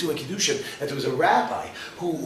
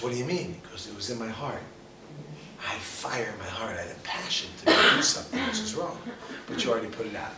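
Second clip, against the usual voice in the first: hushed exchange; 4 semitones lower, 7 dB quieter than usual. Retold or imitated. Retold soft exchange after false alarm.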